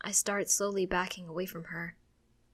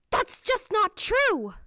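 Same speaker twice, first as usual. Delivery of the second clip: high, loud, drawn-out shout; raised-voice bark; 14 semitones higher, 8 dB louder, slower than usual. A narrator's reading. Mother startling her child, sharp high shout. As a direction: shout the denial, pitch leaping up. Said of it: "never heard her raise her voice like that".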